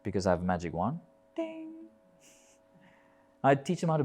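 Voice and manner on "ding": ding sound. Retold or imitated. Magic wand sound.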